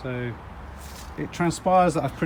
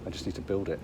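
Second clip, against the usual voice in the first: whispering; 9 semitones lower, 11 dB quieter than usual. Real, not acted. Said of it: "Do you know why you're whispering".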